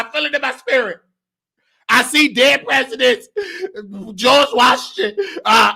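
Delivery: hammed-up fake psychic, rising to shrill cries; psychic hotline. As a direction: play a greedy fortune teller in a trance. Fake psychic reading for money.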